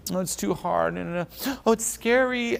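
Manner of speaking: mock someone who finds it all too hard; whiny grief. Grieving person complaining it's too hard, mock whine. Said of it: "Grief is".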